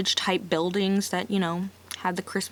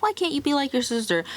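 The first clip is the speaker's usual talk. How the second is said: higher, fast mock nag; nagging family; 6 semitones higher, faster than usual. Family comparing Angela to her sister, mock nagging. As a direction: whine it like a pushy relative. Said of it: "sitting there being like".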